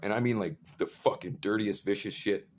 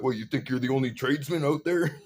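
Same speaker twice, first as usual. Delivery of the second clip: raised, loud, mocking challenge; retold angry taunt; 5 semitones higher, 5 dB louder than usual. Speaker taking on the big dude, loud angry taunt. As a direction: throw the question at him loudly.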